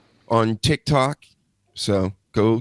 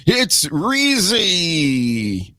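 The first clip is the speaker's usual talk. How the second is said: announcer intro; 6 semitones higher, much slower than usual. Acted. Host introducing guest.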